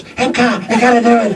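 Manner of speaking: high, pleading mock nagging; pleading producers. Producers pestering him to take the part, high mock pleading. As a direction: beg him over and over in a squeaky voice. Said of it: "I get these phone calls".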